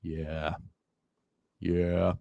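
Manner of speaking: very low raspy yeah; lowest raspy voice. Shown as a demonstration. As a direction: drop the voice as low as it goes. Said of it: "give me the lowest yeah you can with your raspiness".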